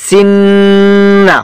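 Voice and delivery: সিন্না with a long, steady hum on ন; held ghunnah. Shown as a demonstration. Teacher demonstrating ghunnah to students, সিন্না with prolonged ন. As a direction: hold the ন of সিন্না very long, then release. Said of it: "কিছুক্ষণ সময় দূরে রাখতে হবে".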